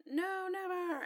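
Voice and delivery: high, drawn-out mini tantrum; mock mad outburst. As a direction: squeal a melodramatic refusal without raising the volume. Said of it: "Jon getting mad".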